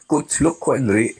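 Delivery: chuckling through a teasing insult; amused mockery. Real, not acted. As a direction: laugh as you tease him. Laughs while mocking the man.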